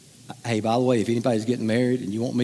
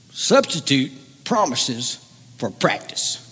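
Dabo Swinney. Acted. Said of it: "I tried to do his voice earlier, and it sounded like a really crazy redneck".

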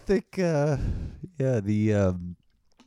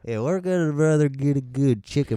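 light Texas tourist voice; Texan tourist; 6 semitones higher, 5 dB louder than usual. Pretend. Podcaster joining in mocking American tourists, lightly.

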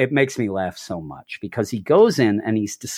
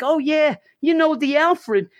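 much higher, put-on voice of a cheery neighbor lady; Cindy impression; 11 semitones higher than usual. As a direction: play the delighted neighbor, pitched way up. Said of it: "she's like".

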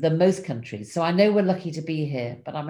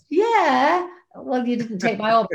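Martin's voice; 8 semitones higher, 5 dB louder than usual. Retold or imitated. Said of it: "And Martin would say".

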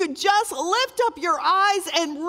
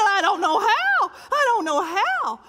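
husband's helpless reply; 6 semitones higher, 6 dB louder than usual. Retold or imitated. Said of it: "And he said".

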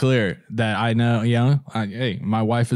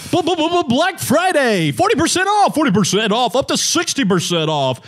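hyped sale ad voice; 13 semitones higher than usual. Retold or imitated. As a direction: shout the sale like a TV ad.

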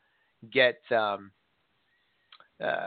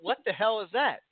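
startled; 8 semitones higher than usual. Retold or imitated. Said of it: "and I'm like".

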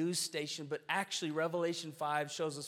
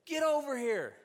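much higher, louder calling out the front door; retold yell; 11 semitones higher, 6 dB louder than usual. Wife calling neighbors in from the front door, loud yell. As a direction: holler to the passers-by to come in. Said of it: "and she yells".

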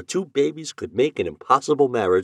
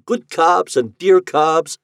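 fond calling; 6 semitones higher, 5 dB louder, slower than usual. A narrator's reading. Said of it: "called him".

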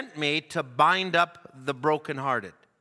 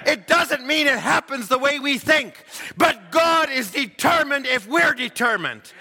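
loud, high, shouted sermon climax; fiery preaching; 7 semitones higher, 4 dB louder than usual. Acted. Preacher urging the congregation to trust God, shouting. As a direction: lift the church with a rising shout.

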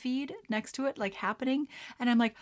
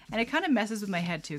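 normal talking voice

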